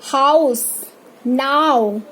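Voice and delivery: हाउस, नाउ gliding from aa to u; diphthong demonstration. Shown as a demonstration. Teacher models double sound, aa-to-u glide in हाउस, नाउ. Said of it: "ये बड़े अ का symbol है".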